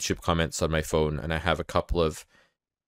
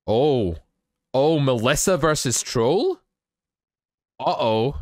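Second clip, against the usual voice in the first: higher, drawn-out playful dread; mock alarm; 7 semitones higher, 4 dB louder, slower than usual. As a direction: tease an ominous discovery. Mock alarm at a troll comment.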